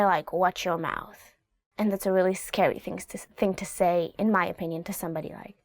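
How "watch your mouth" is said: softer, lower menacing warning; low quiet warning. Shown as a demonstration. Parent to a cursing child, low and quiet. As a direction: say it low so it lands. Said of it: "you can say".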